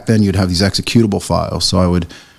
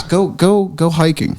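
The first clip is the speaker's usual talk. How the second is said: higher, keen mock push to go; eager urging; 8 semitones higher, slower than usual. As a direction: urge him outdoors with some enthusiasm. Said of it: "He goes".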